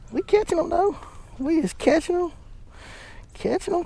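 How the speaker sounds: high cooing pet voice; doggy talk. Acted. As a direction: coo it to your pup like baby talk. Cooing to the dog.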